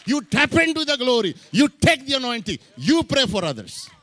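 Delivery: loud, high exhortation; fiery preaching. Acted. Pastor rebuking the church, loud and fiery. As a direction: drive it home to the church.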